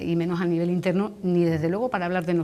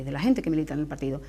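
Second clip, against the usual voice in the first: ordinary talk, nothing marked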